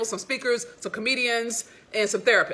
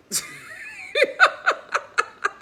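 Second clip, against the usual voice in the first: a sudden high laugh; high burst of laughter; 3 dB louder than usual. Real, not acted. Amused at her man's therapy words, laughing high. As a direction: laugh out loud, high and bright.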